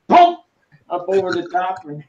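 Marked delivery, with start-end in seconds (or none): 0.1-0.4 high, loud pop of impact; imitated thump. Telling friends how the bug went over the top, imitated thump. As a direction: punch out a sudden high impact noise. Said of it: "and he went".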